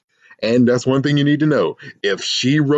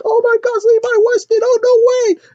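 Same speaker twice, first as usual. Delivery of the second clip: very high, louder mock fan squeal; 4 dB louder than usual. One student recognizing the rock star, squealing. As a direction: shriek it like a starstruck fan.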